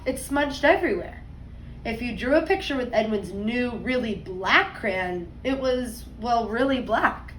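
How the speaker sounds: put-on lively read-aloud voice for kids; story narration. A narrator's reading. Teacher reading a picture book to her class, storytime voice.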